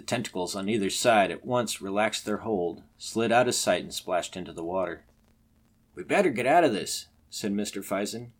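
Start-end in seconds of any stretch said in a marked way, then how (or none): 5.9-7.1 raised, louder voice with a touch of fear; alarmed urging. Acted. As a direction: urge the others away, mildly alarmed. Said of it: "who was trembling violently".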